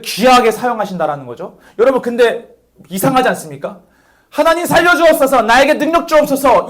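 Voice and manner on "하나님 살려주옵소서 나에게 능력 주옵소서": high, loud fervent plea; typical prayer. Retold or imitated. How believers usually pray for strength, fervent. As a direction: cry out for strength with fervor. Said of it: "이렇게 기도하는 게".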